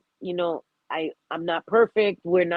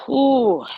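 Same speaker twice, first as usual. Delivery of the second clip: shaky exhale; 7 semitones higher, 6 dB louder than usual. Real, not acted. Emotional release after grief.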